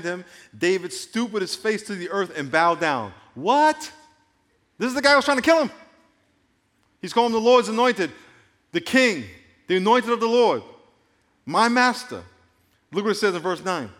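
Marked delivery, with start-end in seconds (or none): 3.3-12.2 loud, high disbelief at the line; can you believe this, pitched up and loud; astonished. Real, not acted. Preacher to congregation, amazed David honors Saul: loud astonished disbelief.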